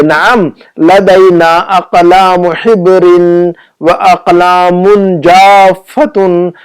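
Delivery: very slow, every long vowel stretched; slow model reading. Shown as a demonstration. Teacher reading the shopkeeper's Arabic answer to students, slow and drawn-out. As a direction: read it out syllable by syllable.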